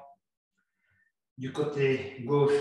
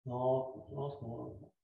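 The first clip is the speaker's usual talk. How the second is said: each lentement softer, dying away; fading softly; 5 dB quieter than usual. Shown as a demonstration. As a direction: let the words fade with the breath. Yoga teacher slowing the exhale. (lentement, de plus en plus bas)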